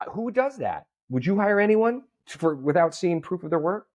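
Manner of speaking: high-pitched, imitated disbelieving questions; retold incredulity. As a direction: imitate a friend who can't believe it. Retold friend's disbelief at the story.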